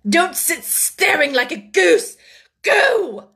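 loud, slow scolding rising to a shout; high, angry command; fierce. A narrator's reading. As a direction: scold her, then fire off the last word. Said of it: "was the fierce answer".

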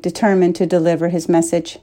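ordinary delivery, nothing marked

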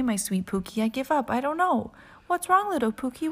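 plain reading voice

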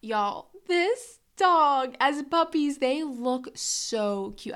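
gushing over cuteness. Real, not acted.

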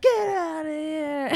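slow, drawn-out strained order; dying dad's plea. Retold or imitated. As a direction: imitate a dying father urging escape. Acting dying dad urging son.

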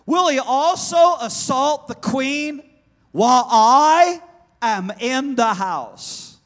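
loud, high, slow royal outrage; outraged shout. Acted. King catching Haman assaulting his queen, outraged shout. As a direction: roar it slowly, stretching the words in indignation. King's outraged quote in Esther story.

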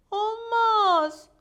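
high, loud, drawn-out no; dramatic refusal. Acted. Maya turns down her friend's invitation, high dramatic refusal.

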